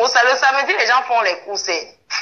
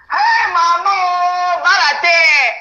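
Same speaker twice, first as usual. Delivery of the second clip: high, loud, long-drawn cry; wailing exclamation; 7 semitones higher, 5 dB louder, much slower than usual. Real, not acted. Streamer crying out to mama, wailing exclamation. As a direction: stretch the first word into a wail.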